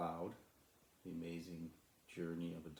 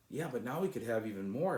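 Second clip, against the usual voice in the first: higher, louder eager push; eager exclamation; 6 semitones higher, 8 dB louder than usual. Acted. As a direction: insist there's even more to have. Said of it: "exclaimed Sly".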